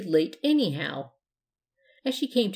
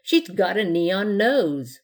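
excited; 6 dB louder, slower than usual. A narrator's reading. Man shows guests the glowing nose, excited. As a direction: show it off excitedly, stretching the words out. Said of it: "said the man excitedly".

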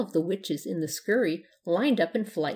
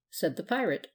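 usual speaking voice

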